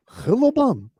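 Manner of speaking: put-on high, slow, accented interviewer voice; Christos impression. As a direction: draw out questions in a high foreign accent. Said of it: "He's gotta be the only guy who's still doing it from Zoom".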